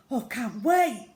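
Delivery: loud, slow, eager "can't wait"; bursting with eagerness; excited. Acted. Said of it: "said Tina excitedly".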